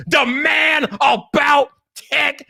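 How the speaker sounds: high shout with MAN and ABOUT drawn out; WWE promo. Shown as a demonstration. Streamer showing how his catchphrase goes, shouted WWE promo. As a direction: stretch it slowly like a wrestling announcer. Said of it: "cut like a W W E promo".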